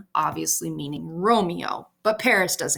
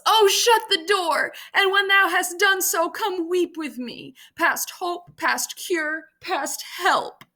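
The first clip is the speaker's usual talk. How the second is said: acted yell; 10 semitones higher than usual. Juliet freaks out once Paris leaves, high yell sinking to a plea. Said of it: "and she yells".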